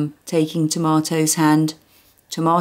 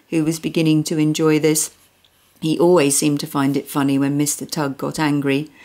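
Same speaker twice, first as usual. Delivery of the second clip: usual speaking voice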